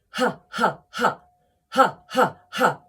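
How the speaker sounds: deliberate ha. Shown as a demonstration. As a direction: punch out each ha like a laugh. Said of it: "And again".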